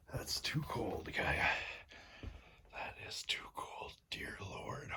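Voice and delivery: shivering whisper. Real, not acted. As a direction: whisper through the cold. Whispered cold complaint.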